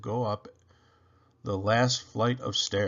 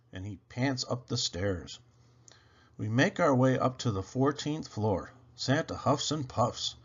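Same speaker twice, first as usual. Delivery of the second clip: usual speaking voice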